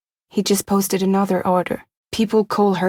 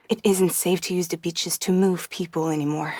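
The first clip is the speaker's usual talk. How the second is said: usual speaking voice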